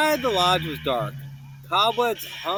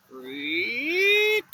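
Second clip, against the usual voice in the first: creak; 6 semitones higher than usual. A narrator's reading. Door creaking sound.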